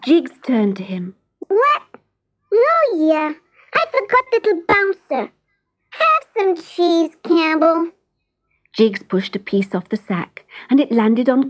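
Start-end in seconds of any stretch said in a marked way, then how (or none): 1.4-7.9 high squeaky mouse, boastful; cocky mouse. Acted. Jiggs boasting to the cat, high and squeaky. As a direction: crow it like a cheeky mouse.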